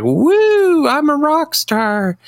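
hammed-up rock star yelling to a crowd; mock rock star cheer. Guard on an empty stage, mock-cheering, high and slow. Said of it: "And he's like".

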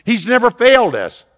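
loud, high, ringing preacher's build; full-voiced pulpit proclamation; preaching at full force. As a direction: proclaim it to the whole congregation.

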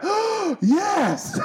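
big gasp, then high-pitched delighted cry; thrilled audience. Retold or imitated. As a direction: imitate an overjoyed moviegoer gasping and cheering. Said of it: "I was so happy".

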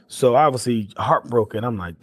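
ordinary delivery

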